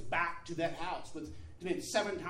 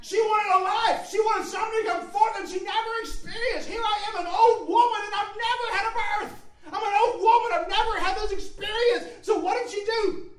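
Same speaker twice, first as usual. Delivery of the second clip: high, loud, driving sermon delivery; impassioned pulpit shout; full-force preaching; 11 dB louder than usual. Real, not acted. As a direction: preach it out at the top of your range.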